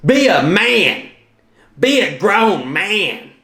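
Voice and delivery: high, drawn-out mock yell of a little girl; imitated yelling. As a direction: yell it slowly and high, imitating a scolding daughter. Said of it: "she starts yelling out loud".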